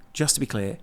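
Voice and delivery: low, softly pointed question; quietly making a dig. Retold or imitated. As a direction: needle them with mock-innocent restraint. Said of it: "make a very pointed point".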